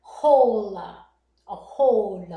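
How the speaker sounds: hole stretched out and hit hard; drawn-out, emphatic hole; stress on hole. Shown as a demonstration. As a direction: say hole slowly and loudly, setting it apart.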